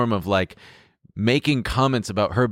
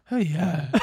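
imitating his date's voice; 8 semitones higher than usual. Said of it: "Not only is this exactly how she sounds".

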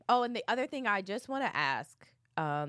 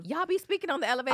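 real disbelief; 6 semitones higher, 3 dB louder, much faster than usual. Real, not acted. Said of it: "people are saying they be speaking".

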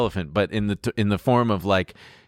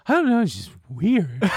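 imitating weird date; 10 semitones higher than usual. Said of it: "this exactly how she sounds".

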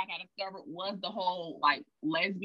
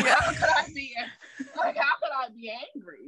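incredulous; 6 semitones higher than usual. Real, not acted. Incredulous rhetorical question.

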